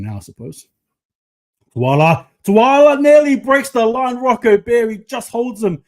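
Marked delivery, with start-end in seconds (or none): 1.8-5.8 high, loud, stretched-out excitement; shouted play-by-play. Real, not acted. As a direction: cry out the near breakaway, dragging the words. Near line break in live play.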